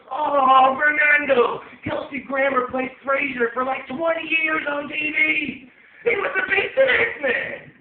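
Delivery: very high, full-volume comic tirade; yelling. Acted. Owner busting in to yell at his dog, hammed-up yelling. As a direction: bellow it with stretched-out words. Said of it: "yell at him anything I want".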